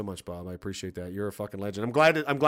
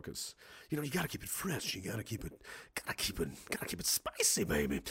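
teasing breathy whisper, much softer; 5 semitones lower, 13 dB quieter than usual. Pretend. Streamer confides to chat about keeping music fresh, teasing whisper. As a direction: lean in and whisper it like a secret.

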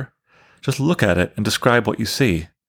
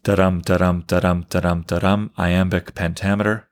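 da-DUM, da-DUM, a strict even beat with the stress on every DUM; metronomic da-dum. Shown as a demonstration. Teacher showing students Shakespeare's iambic beat, strictly metronomic. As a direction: tap out "da-dum" like a ticking metronome. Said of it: "this very metronomic".

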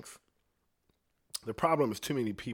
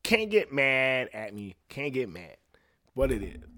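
ordinary talk, nothing marked

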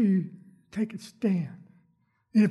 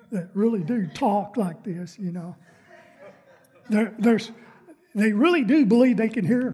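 normal talking voice

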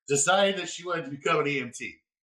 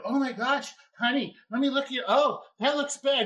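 higher motherly coo; sweet old lady; 9 semitones higher than usual. Retold or imitated. Retired teacher partner cooing at the patient, higher and sweet. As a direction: fuss over her like a kind grandma. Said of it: "my partner at the time is like".

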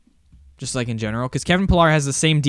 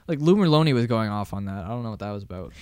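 ordinary delivery

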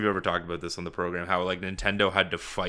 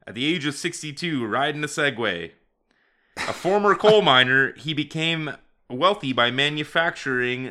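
mock formal read; 5 semitones higher, 3 dB louder than usual. Reads Wikipedia entry aloud.

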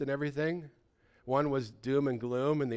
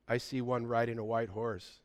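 ordinary delivery

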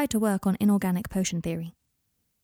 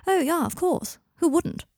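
high-pitched, insincere agreement; airy sarcasm; 9 semitones higher, slower than usual. Acted. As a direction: agree far too readily, pitched high. Client's sarcastic reply about office.

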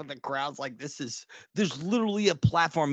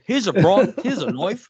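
loud, hammed-up Australian accent, laughing; a Crocodile Dundee style Australian accent, played loud; mock Australian hero voice, much louder; bad Australian accent; 5 semitones higher, 8 dB louder than usual. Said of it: "I have terrible accents".

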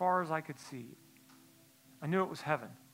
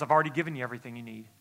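quick calm answer; 5 semitones lower, much faster than usual. Retold or imitated. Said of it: "And he goes".

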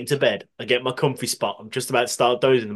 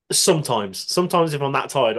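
ordinary delivery

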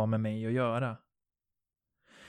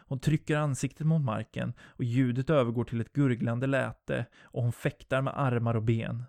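ordinary delivery, nothing marked

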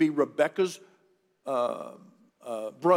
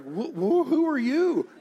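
shocked stammer; 10 semitones higher than usual. Retold or imitated. Jacob finds the wrong bride.

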